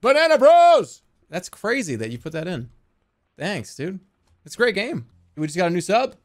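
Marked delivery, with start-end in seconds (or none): 0.0-1.0 catchphrase shout. Retold or imitated. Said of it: "I fucking go".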